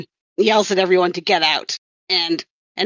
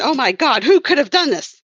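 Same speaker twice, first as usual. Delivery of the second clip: mock distraught; 6 semitones higher than usual. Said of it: "she is very understandably upset".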